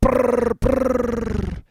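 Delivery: loud, high rolled brrr; rapid-fire mouth-made metal noise; imitated metal riff. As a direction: roll out a forceful buzzing trill. Imitated noise after Metallica yell.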